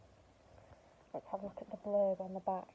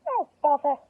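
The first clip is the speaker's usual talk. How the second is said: loud, high irritated exclamation; annoyed oh bother; 6 semitones higher, 11 dB louder than usual. Acted. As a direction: huff it in frustration. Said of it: "said Winnie".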